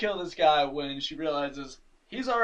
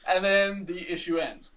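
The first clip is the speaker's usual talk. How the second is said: ordinary talk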